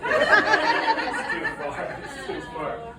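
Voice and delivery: laughing at the silent crowd. Real, not acted. Laughing after no answer.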